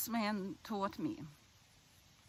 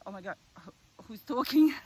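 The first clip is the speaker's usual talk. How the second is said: startled; 2 semitones higher, 8 dB louder, faster than usual. Retold or imitated. Startled reaction to a voice, retold.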